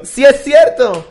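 high, slow, drawn-out sarcastic concession; sarcastic mock agreement, drawn out. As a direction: stretch it out high like a sarcastic yes.

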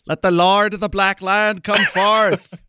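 mock-heroic Irish accent; Irish-accented Aragorn. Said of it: "an Irish accent that no other".

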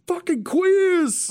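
very high, slow, drawn-out mobster snarl; extremely high, stretched-out gangster insult; Paulie's parting shot. Retold or imitated. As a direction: throw the chair and spit it out slowly. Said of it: "picks up the chair and chunks it".

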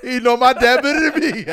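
high, loud, laughing disbelief; loud words breaking into real laughter; laughing incredulously. Real, not acted. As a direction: crack up while saying it. Laughing at friend's absurd claim.

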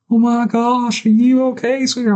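high, concerned sweet-lady voice; kind lady. Retold or imitated. S1 mimics a kind lady at the grocery store, high and doting. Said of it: "and she's like".

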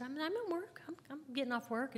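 high, lilting cover-up; stammered, falsely breezy excuse; sing-song denial. Retold or imitated. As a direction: protest lightly in a high voice, as if nothing's wrong. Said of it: "In the sing-song voice that we do".

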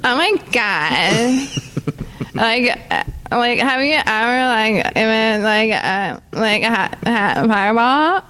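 put-on valley-girl American accent, lots of "like"; California girl accent. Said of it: "can you do an impersonation of an American".

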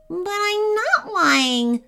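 high, drawn-out whine; whining Clunky. A narrator's reading. Caged Clunky protests to the dragon, whining. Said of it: "whined Clunky".

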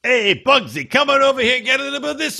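high, rapid Brooklyn Italian shouting; hammed-up Brooklyn Italian accent. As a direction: call out like a cartoon Brooklyn Italian. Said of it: "save that accent for when appropriate".

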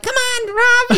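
preschooler voice. Retold or imitated. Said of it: "his little kid voice".

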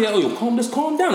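acted calm-down plea. Acts out telling a woman to calm down.

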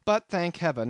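ordinary delivery, nothing marked

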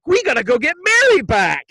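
high, loud mock-dramatic resolve; rally the guys like a movie hero; hammed-up urgent call to action; classic movie rallying cry. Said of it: "Then they have the classic".

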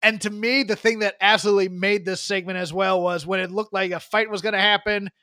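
normal talking voice

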